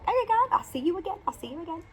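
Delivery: high, stunned goodbye; shocked. Retold or imitated. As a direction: blurt it out amazed. Retold shocked farewell.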